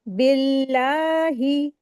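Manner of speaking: बिल्लाही slow, ही short and unstretched; thin लाम in बिल्लाही, ही not drawn; correct tajweed. Shown as a demonstration. Tajweed teacher models the word for students, slow and careful. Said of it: "तो इसको कैसे पढ़ेंगे?".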